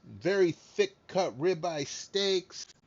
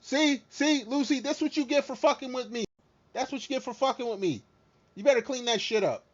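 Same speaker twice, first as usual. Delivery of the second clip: taunting; 7 semitones higher, 3 dB louder than usual. Real, not acted. Taunting Lucy after her mess.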